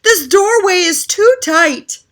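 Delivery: high, loud whining; whining cry. Acted. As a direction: complain loudly. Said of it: "cried Moose".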